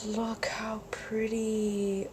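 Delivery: slow breathy whisper of wonder; awed whisper. Real, not acted. Vlogger admiring paper to viewers, slow awed whisper. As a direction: hush it like something precious.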